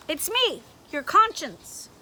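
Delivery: loud, stern scolding voice; a bossy inner voice catching him out; stern conscience. Acted. Conscience introduces itself.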